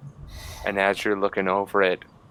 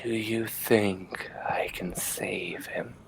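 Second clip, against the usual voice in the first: slow, secretive whisper; 9 dB quieter, much slower than usual. Acted. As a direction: lean in and whisper it slowly. Doctor leans in, asks secretly.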